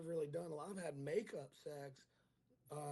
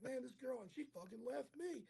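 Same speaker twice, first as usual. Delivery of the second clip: tag; 9 semitones higher, 4 dB quieter than usual. Retold or imitated. Retold complaint about being left.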